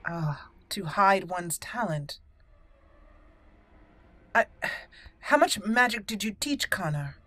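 ordinary talk

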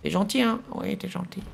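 put-on high baby talk to a pet; cooing at a pet. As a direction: coo at the little dragon. Cooing at a little dragon.

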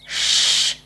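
whispered shh; shushing. A narrator's reading. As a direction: hush her gently. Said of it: "said Pip".

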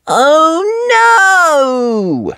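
high whiny oh no; a little wail at the rain; disappointed cry. Acted. Peppa crying out as it starts to rain, whiny. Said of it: "cries Peppa".

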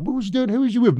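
high, questioning dad voice; a father interrogating his son. Retold or imitated. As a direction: press the kid for answers like a worried dad.

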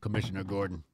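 low superhero impression; Batman. Co-host joking about the deep voice, low mock superhero. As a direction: drop low, naming the police commissioner. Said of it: "Brittany Griner sound like Batman".

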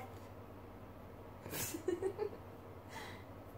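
high breathless giggle; giggling while being tickled; ticklish laughter. Real, not acted.

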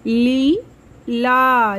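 Leela with the ली held long and slow; slow stretched long ई. Shown as a demonstration. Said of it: "आवाज़ थोड़ी सी लंबी". (लीला की ली को लंबा खींचना)

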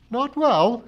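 high, loud, indignant echo; truculent. A narrator's reading. Missus Pringle challenges talk of the narrator's health, truculent. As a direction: throw the words back truculently. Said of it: "she echoed truculently".

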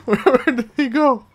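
loud, high, baffled cry; shouting where he went; startled high yelp. Real, not acted. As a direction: call out in alarmed confusion. Confused shout chasing enemy.

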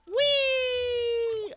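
high gleeful squeal while flying; high gleeful whee; playful whee. Pretend. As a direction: squeal like on a ride. Flying around on pet.

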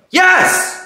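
sharp, high-pitched outburst; exasperated yell. Acted. Mom answering husband's obvious question, exasperated yell. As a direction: cry it out with impatience.

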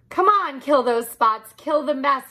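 high, loud rallying yell. Acted. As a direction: charge in, cheering each other on. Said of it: "yelled the cats".

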